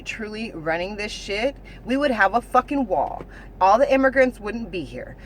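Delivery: usual speaking voice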